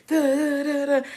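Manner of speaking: high-pitched rapid-fire stutter sound; imitated throwing noises. Woman miming flinging his clothes out, frantic noises. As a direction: voice a flurry of things being flung.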